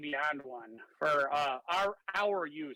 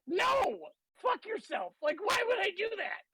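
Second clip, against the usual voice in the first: high-pitched incredulous protest; indignant, much higher voice; mock outraged refusal; 13 semitones higher than usual. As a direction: squeal it as a baffled adventurer turning down a quest. Acts out adventurer refusing the quest.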